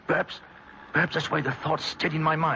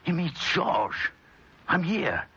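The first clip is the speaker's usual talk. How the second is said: ordinary talk, nothing marked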